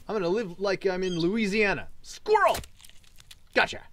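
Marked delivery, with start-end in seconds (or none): 2.0-3.8 high, loud squeal of delight; excited shout. Real, not acted. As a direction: shriek it like spotting prey. Chasing squirrel.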